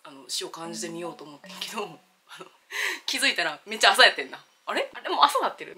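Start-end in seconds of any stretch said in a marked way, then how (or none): 4.6-5.8 mock surprise. Waking up surprised in retold story.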